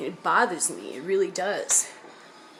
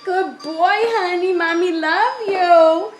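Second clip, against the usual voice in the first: very high, loud doting voice; 12 semitones higher, 8 dB louder, slower than usual. Real, not acted. Mother talking to her baby.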